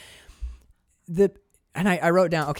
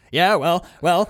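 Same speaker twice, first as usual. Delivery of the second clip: louder, sputtering indignant bluster; 3 semitones higher, 7 dB louder than usual. Retold or imitated. Driver blustering at the hitchhiking hippie, louder and sputtering. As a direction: splutter back, offended and defensive. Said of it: "starts to, like, bluster and yell at him".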